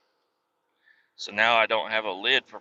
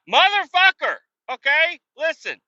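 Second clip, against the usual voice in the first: much higher, louder, exasperated blowup; exasperated outburst; 6 dB louder than usual. Retold or imitated. Fed up with the instructor's orders, much higher and louder. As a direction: explode at the endless orders.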